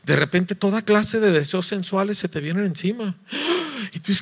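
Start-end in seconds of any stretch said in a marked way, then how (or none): 3.2-3.9 mock gasp. Sudden rush of desire.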